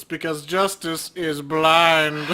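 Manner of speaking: drawn-out punchline; milk the joke; slow pun. Real, not acted. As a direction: land the pun slowly. Pun delivered slowly.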